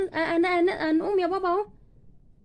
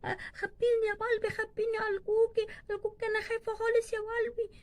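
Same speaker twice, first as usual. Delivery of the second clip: put-on frightened little child; 3 dB quieter than usual. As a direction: beg to be hidden, nearly crying. Scared child begs to be hidden. (رجاء طفلة خايفة باكية)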